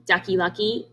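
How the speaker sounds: ordinary delivery, nothing marked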